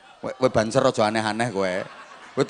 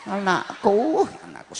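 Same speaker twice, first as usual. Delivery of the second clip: bursting with pride; 9 semitones higher than usual. Retold or imitated. Mother walks past neighbours, swooning with pride over her son. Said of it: "saking banggane".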